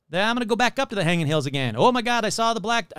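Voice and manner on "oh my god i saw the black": higher-pitched exclamation, cut short; mock panic. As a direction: play a caller alarmed by a sighting.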